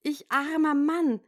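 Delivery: narrated woeful lament; lamenting cry. A narrator's reading. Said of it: "rief er wieder".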